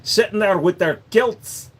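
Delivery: slow mock Scottish lilt; lightly put-on Scottish accent. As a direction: drawl it like a tipsy Scot.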